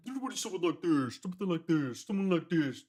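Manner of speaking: sung with a darker, formant-shifted tone; darkened vocal demo. Shown as a demonstration. Producer demos the darkened breakdown vocal, sung darker. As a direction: croon the hook like it's formant-shifted down. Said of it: "You darken it".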